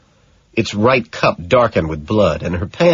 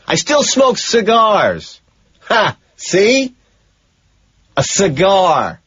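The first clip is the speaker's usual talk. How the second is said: high, slow manic crowing; triumphant gloating; 11 semitones higher, slower than usual. Acted. Killer gloating over his victim about cigars, manic high crowing. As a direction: gloat wildly, almost laughing. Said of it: "I scream triumphantly".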